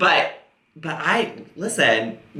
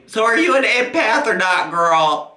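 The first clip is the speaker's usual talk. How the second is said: ordinary talk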